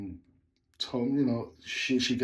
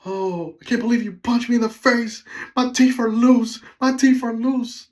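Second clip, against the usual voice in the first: upset woman; 11 semitones higher, 8 dB louder than usual. Retold or imitated. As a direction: wail it like the hurt passenger. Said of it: "that's when she says".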